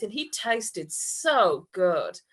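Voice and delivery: ordinary talk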